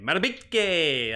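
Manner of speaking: Matabike with the final "kay" stretched long; drawn-out correct name. Shown as a demonstration. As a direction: say Matabike as "matta-BEEK-kaaay", slowly. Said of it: "His name is pronounced Matabike".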